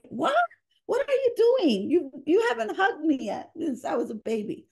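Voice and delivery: put-on very high, loud child's voice; shocked child asking; surprised kids. Kids voiced in her story.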